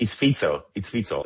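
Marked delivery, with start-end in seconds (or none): 0.1-0.6 "Fico" said FEET-so, with a ts; correct pronunciation. Shown as a demonstration. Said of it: "Like we pronounce pizza". 0.9-1.2 "Fico" said FEET-so, with a ts; correct pronunciation. Shown as a demonstration. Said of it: "Like we pronounce pizza".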